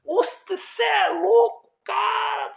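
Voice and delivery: high, loud, stretched-out disbelief; shocked disbelief. Retold or imitated. Friend reacting to the copied books, crying out in high disbelief. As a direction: cry out in stunned shock.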